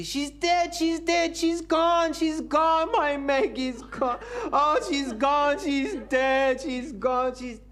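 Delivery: very high, loud mock wailing; hammed-up sobbing. Professor sobbing over his dead wife, very high mock wail. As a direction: wail like a grieving widower, overdone. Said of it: "he's crying".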